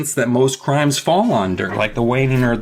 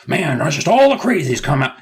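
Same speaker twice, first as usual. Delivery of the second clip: cop voice; 5 semitones higher, 4 dB louder than usual. Retold or imitated. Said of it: "a cop who's just like".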